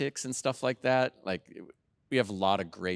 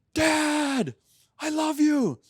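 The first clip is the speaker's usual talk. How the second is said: much higher and louder, gushing mock teen; excited teenage son; 7 dB louder, much slower than usual. Son calling dad after winning, gushing. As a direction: imitate a thrilled son calling after midnight. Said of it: "It started out with".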